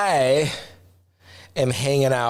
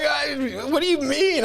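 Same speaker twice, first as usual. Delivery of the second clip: very high, cracking with laughter; laughing disbelief; slower than usual. Real, not acted. Dad on the phone with his stuck son, laughing high. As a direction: squeak it out through real laughter. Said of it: "I'm laughing".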